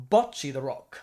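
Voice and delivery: put-on British "Bocce" with a short rounded o; mock British pronunciation; British accent. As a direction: imitate a British speaker saying BOTCH-ee. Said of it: "let me take my British accent".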